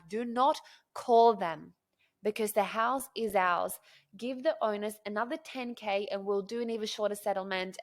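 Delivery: loud, emphatic retold demand; forceful insistence. Buyer orders agent not to call rivals, forcefully insistent. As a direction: lay down the law, punching the words.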